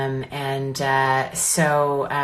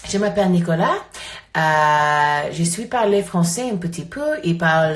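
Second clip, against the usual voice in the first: halting schoolbook French, English accent; foreign accent in french; 4 dB louder than usual. Shown as a demonstration. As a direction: try out rusty French. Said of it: "Try to speak French".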